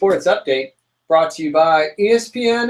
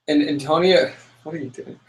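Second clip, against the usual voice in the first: ordinary delivery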